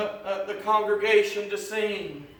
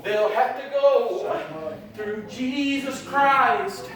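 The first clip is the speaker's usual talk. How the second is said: loud, rising preacher's cadence, pushing each phrase; full-force preaching; 4 semitones higher, 7 dB louder than usual. Acted. Preacher exhorting the congregation about salvation, full-force preaching.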